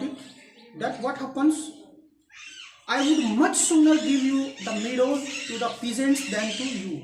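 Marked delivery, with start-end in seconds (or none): 2.9-7.0 angry father. Acted. Father's angry retort read aloud.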